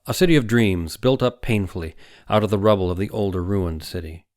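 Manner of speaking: plain reading voice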